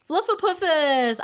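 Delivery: high, loud, slow gushing cry; excited. Acted. Streamer to chat about the name, high excited gush. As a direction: gush it out delightedly.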